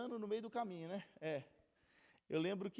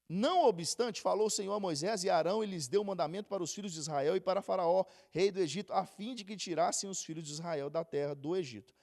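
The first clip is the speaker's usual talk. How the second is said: scripture reading; 6 dB louder than usual. Acted. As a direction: proclaim the verse to the congregation. Reads scripture aloud. (leitura bíblica proclamada)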